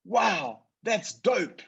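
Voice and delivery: a lightly voiced teenage boy, impressed. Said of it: "he looks at her and he says".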